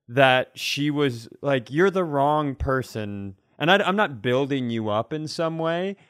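ordinary delivery

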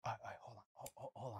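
imitating himself hushing to stop; mock hushed panic. Retelling his panicked stop to his co-host, whispered. Backing off mid-sex, retold.

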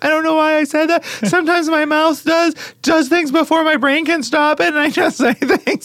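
put-on high child voice, slowed, explaining herself; high remorseful kid voice with a laugh; tearful little girl voice. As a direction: play the daughter sorry after bawling. Daughter voiced after crying.

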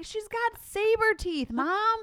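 put-on high, slow whiny kid voice; high childish protest about the hamster; little boy complaining. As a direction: play the son grumbling to Mom. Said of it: "And he's like".

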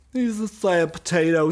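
sad potato mascot, mildly voiced; Al Gore impression. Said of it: "sounds a little like Al Gore".

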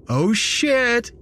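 high, gleeful jock voice; smiling delight. Acted. Idiot jock reacting to the victim's claim, smiling high exclamation. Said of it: "Derek said with a smile".

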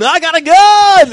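high, loud, slow hammed-up yell; over-the-top mock gunman yell; mock shout. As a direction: bellow it like a clueless improviser. Improvisers acting out scene.

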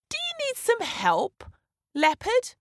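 high, lilting bird voice; high-pitched offer of help; helpful bird. A narrator's reading.

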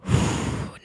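long, soft exhale; deep sigh. Real, not acted. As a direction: blow the breath out slowly. Emotional release after heavy story.

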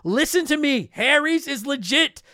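ad read. Acted. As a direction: sell it like a podcast ad spot. Host reads sponsor ad.

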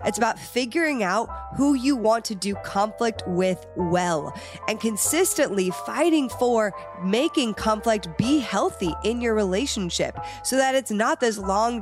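ordinary delivery

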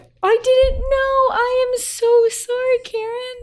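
upset sympathy. Real, not acted. Consoling a friend whose dog died, upset sympathy. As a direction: pour out sorrow for a grieving friend.

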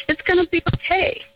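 put-on high little-boy voice promising it's fine; little boy comforting. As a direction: soothe your mom like a small boy would. Child comforting mother, voiced.

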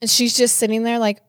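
ordinary talk, nothing marked

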